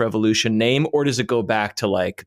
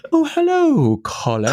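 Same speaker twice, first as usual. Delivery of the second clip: put-on Mid-Atlantic accent; 3 dB louder than usual. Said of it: "work very hard to say".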